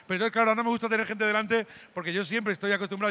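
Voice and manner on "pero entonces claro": normal talking voice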